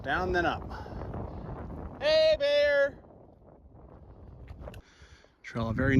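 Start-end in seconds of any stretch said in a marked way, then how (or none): 2.0-2.9 scaring off a bear. Real, not acted. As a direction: shout to warn off bears. Calling out on the trail.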